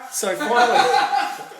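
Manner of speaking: words pushed out through loud laughter; can't stop laughing as he gets to it; laughing while talking. Real, not acted. Telling friends the frog story, laughing hard.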